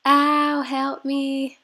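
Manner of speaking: loud, high joking "ow"; mock cry for help. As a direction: playfully fake being in pain. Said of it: "I was gonna voice this part over and go like".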